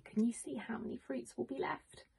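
normal talking voice

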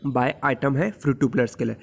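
usual speaking voice

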